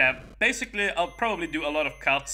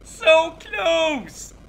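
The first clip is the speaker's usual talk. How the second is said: loud, high near-miss yell; excited shout; 13 semitones higher, 12 dB louder, much slower than usual. Real, not acted. Nearly clears the stage.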